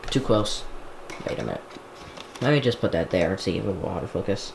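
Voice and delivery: ordinary talk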